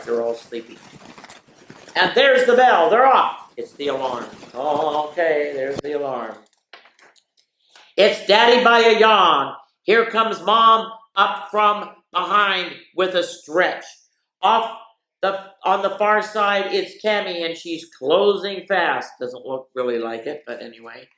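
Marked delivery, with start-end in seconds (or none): 2.0-3.5 raised, excited play-by-play call; race announcer. Acted. Mock horse-race call of family morning. 8.0-19.1 raised, excited play-by-play call; race announcer. Acted. Mock horse-race call of family morning.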